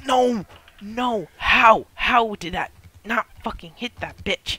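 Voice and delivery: high, loud disbelieving yell; outraged shout. Real, not acted. Missed hit, outraged.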